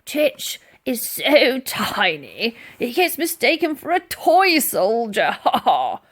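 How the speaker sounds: put-on a gruff old soldier chuckling at his own joke; hearty mocking chortle; mocking laugh. A narrator's reading. Sergeant major mocks, laughing.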